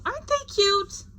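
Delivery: gushing over the little states; Uncle Sam cooing. Acted. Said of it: "Uncle Sam saying".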